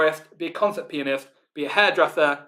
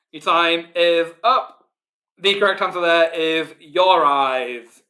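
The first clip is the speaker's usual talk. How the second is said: drawn-out quiz announcer; 6 dB louder, slower than usual. Acted. Quiz host announcing time up.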